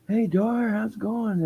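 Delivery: high-pitched imitation of a store clerk; imitated cheery greeting. Store clerk greets a regular customer, imitated high greeting.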